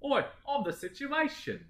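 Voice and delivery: high-pitched English caricature; mock English accent. Jersey Shore if based in England, mock English accent. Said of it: "should have been based in England".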